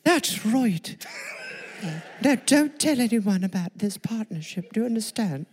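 a hint of a British accent, hushed toward the end; British Whisperer voice. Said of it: "Brit Jemmy the Whisperer".

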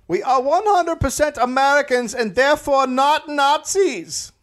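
high, hammed-up pompous German leader; mock pompous declaration; mock Fritz.

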